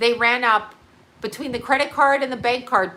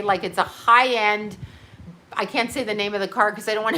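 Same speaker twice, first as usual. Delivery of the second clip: ordinary talk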